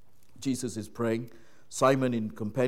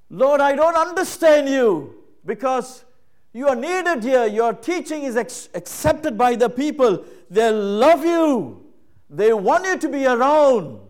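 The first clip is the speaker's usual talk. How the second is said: higher and louder pleading; urgent plea; 12 semitones higher, 12 dB louder than usual. Retold or imitated. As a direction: push hard, insisting he stay. Disciples urging Jesus to stay.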